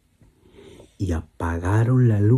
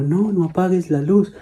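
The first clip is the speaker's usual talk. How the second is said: higher, anxious begging; pleading girl; 9 semitones higher than usual. Acted. Girl begging her father, anxious and high. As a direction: beg like a frightened child.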